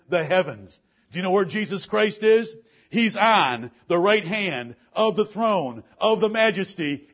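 loud, high, hammering preacher's cadence; fiery preaching. Acted. Preacher exhorting his church, loud and driving. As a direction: preach it to the congregation full force.

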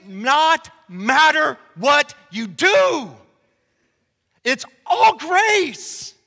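slow, loud, drawn-out pulpit lines; building sermon fervor; emphatic preaching. Acted. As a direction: hammer each phrase home slowly.

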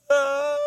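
high-pitched held squeal; a thin, very high "ah" of fright; frightened squeal. Retold or imitated. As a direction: let out a tiny, sustained high yelp. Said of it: "and I'm like".

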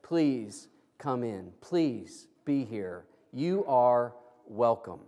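low, slow, heartfelt invitation; welcoming attitude. Acted. Householder asks Jesus to be here, slow welcoming plea. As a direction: draw out each word softly as a sincere welcome. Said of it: "an attitude that says".

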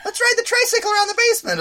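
put-on high, quick excited little-girl voice; hammed-up little sister pitching a game; mock kid sister. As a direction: rush it out like an eager child. Imitating childhood sister.

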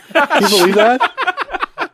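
high laughing disbelief; amused astonishment through laughter. Real, not acted. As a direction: laugh out the question, slowly. Laughing amazement.

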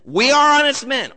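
indignant protest. Acted. Accused brothers answer their tester, loudly insistent.

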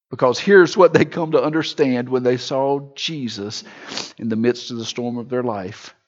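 ordinary talk

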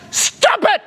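high-pitched comic shout; yelling. Retold or imitated. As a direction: yell it sharply, far above normal pitch. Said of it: "He's just yelling at her".

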